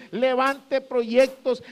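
ordinary talk

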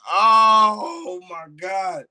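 slow, high, loud cry of joy; overjoyed cry. Real, not acted. Fan reliving his hero's return, crying out with joy. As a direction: let it break out, overwhelmed.

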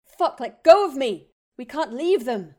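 raw, high desperate yell; frantic shouted protest; screaming. Acted. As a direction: yell it while struggling against his grip. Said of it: "Harry was screaming".

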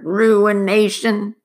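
slow, loud, drawn-out mutter; muttering miner. Acted. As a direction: repeat it grimly, drawn out. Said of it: "the miner began to mutter".

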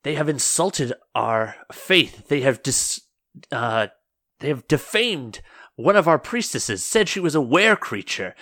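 acted outrage, punching the accusations; angry accuser. Angry churchwoman pleading to Holy Mother against the Slay Dogs, indignant. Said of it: "cut back to the angrier voice".

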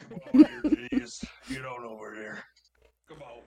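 raspy voice. Retold or imitated. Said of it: "that bad raspy spoken voice".

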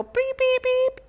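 high mock honking noises; clown nose honk. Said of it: "grab their nose and go".

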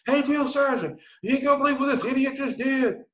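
high-pitched holler to a colleague; imitated sergeant's yell. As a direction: call out as a sergeant to another sergeant. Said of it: "to yell at another drill sergeant".

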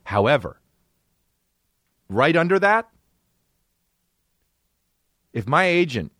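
ordinary talk

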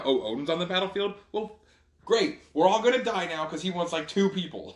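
sarcastic. Retold or imitated. Warriors dreading Odin's arrival.

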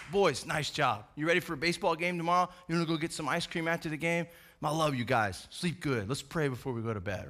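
low, soft, affectionate bedtime voice; tender dad. Acted. Dad coming back to his boys once chores are done, tender. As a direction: drop way down into a gentle father's reassurance. Dad returns lovingly to sons.

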